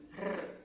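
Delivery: Rrr trilled softly behind the teeth; a quiet tongue-tip Rrr; soft rolled r. Shown as a demonstration. Teacher reminding learners of the trill. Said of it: "vai fazer esse R que vibra aqui".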